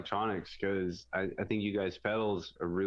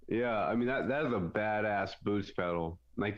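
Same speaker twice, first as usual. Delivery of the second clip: normal talking voice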